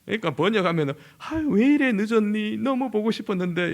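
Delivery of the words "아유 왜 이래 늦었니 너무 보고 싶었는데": 서울 할머니처럼 다정하게; Seoul grandma. Retold or imitated. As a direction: coo it like a gentle Seoul grandmother. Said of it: "서울 할머니들은 그럴 건데".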